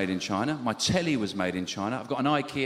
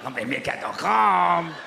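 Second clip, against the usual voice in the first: slow, higher mock Mexican accent; 4 semitones higher, slower than usual. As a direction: sell it slowly in a hammed-up Mexican accent. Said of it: "Or they were Mexican".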